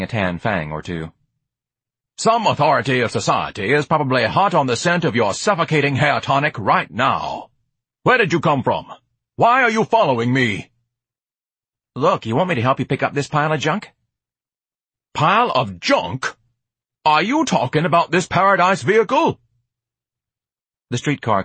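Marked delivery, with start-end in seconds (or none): 2.2-10.7 higher, loud indignant tirade; grandiose, affronted interrogation; pompous Ignatius, accusing. Acted. Ignatius interrogates the boy following him, indignant bluster. 11.9-13.9 higher, casual street voice; pitched up, pushy offer; streetwise hustler, impatient. Acted. George offers to lift the junk, impatient. 15.1-19.4 affronted. Acted. Ignatius defends his Paradise vehicle, affronted.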